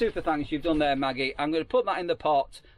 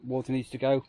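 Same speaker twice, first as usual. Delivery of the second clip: ordinary talk, nothing marked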